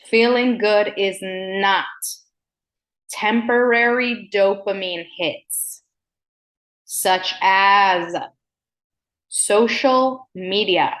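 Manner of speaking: slow, weighty delivery; slow it down for importance; slow and deliberate. Shown as a demonstration. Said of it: "I'm talking slow".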